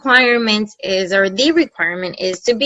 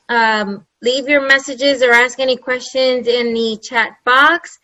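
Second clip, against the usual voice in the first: ordinary talk, nothing marked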